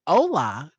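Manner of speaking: usual speaking voice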